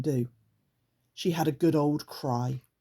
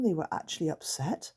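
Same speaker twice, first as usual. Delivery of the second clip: ordinary delivery, nothing marked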